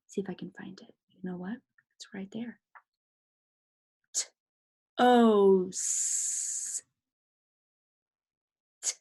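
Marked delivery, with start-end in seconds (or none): 4.1-6.8 "T", "O", "S" as separate sounds; sounding out. Shown as a demonstration. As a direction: hold "O" long, then let "S" hiss. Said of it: "what does toast sound like".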